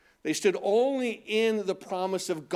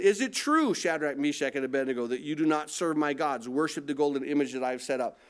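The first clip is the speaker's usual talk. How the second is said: ordinary delivery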